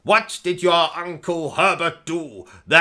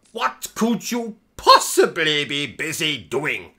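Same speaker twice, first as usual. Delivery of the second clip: ordinary delivery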